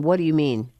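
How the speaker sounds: usual speaking voice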